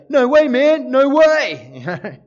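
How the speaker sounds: loud, high Aussie bogan "no way, man". Retold or imitated. Pastor imitating an eighties Aussie bogan for laughs, loud broad Aussie accent. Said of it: "if he was an Aussie bogan from Ingleburn".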